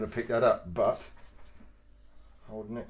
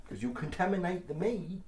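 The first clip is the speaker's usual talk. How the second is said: ordinary talk, nothing marked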